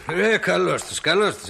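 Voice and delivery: usual speaking voice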